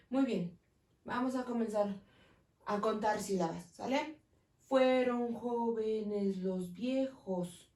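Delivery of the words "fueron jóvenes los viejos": very slow, every syllable spaced; slow syllable count. Shown as a demonstration. Teacher counting syllables slowly for students. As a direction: draw out each syllable of "Fueron jóvenes los viejos". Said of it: "Tenemos ocho sílabas".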